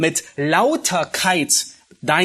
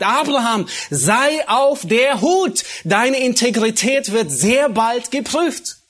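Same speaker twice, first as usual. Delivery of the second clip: God's warning; 7 semitones higher, slower than usual. Retold or imitated. Preacher voicing God warning Abraham to be on guard, slow and urgent. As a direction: call out the warning slowly, like God cautioning a friend. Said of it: "ihn warnt".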